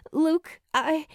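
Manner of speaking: sudden high urgent call; high and hurried; blurting. A narrator's reading. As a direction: blurt it out before he leaves. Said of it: "Julie blurts out".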